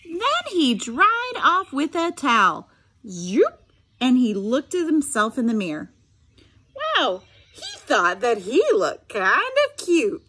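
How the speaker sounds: lively read-aloud voice with sound effects; story narration. A narrator's reading. Teacher reading a picture book to her students, animated storytelling.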